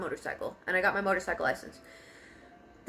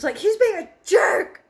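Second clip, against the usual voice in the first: crying and yelling; 11 semitones higher, 10 dB louder than usual. Retold or imitated. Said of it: "And I was crying".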